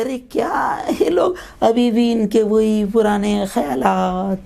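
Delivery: mock scornful woman. Educated women mocking veiled daughters.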